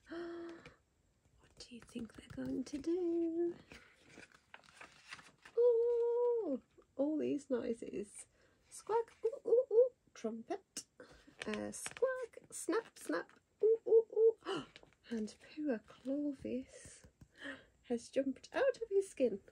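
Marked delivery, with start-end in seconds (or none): 5.6-14.6 high, loud hooting and squawking animal calls; animal noises. Pretend. Jungle animals all scaring the tiger, high loud hoots. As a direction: make the whole jungle shout at once.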